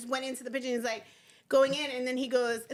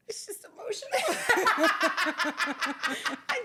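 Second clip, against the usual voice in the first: laughing through it; 4 semitones higher, much slower than usual. Retold or imitated. To the executives, laughing while claiming to be emotional. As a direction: giggle the excuse out helplessly. Retelling her own reply at meeting.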